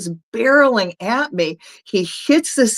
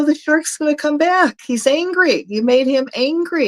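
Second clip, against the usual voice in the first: higher-pitched retold scolding; alarmed protest; 8 semitones higher than usual. Snorkeler protesting to the man who woke the shark, alarmed. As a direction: argue back in a raised pitch.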